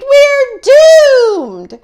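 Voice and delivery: very high, loud scream; terrified scream. Acted. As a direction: scream like all hope is lost. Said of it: "Mitzi screamed".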